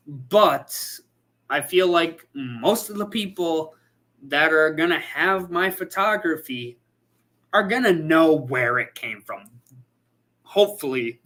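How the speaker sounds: ordinary talk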